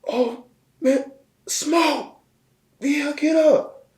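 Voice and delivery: very high, loud drawn-out cry; mock panicked shout, stretched long; imitated frantic yelling. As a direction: holler in falsetto to rouse someone knocked out. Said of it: "all you hear was like".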